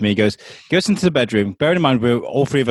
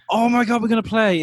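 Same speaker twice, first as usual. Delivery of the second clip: put-on much higher, thrilled kid voice; excited child; 9 semitones higher than usual.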